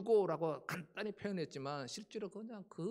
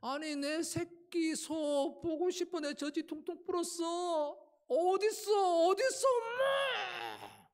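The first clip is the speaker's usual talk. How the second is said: very high, slow crying call; desperate mother cow sobbing out; mother cow wailing; 12 semitones higher, 6 dB louder, slower than usual. Acted. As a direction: wail for the calf, rising to a cry. Voicing mother cow longing for calf.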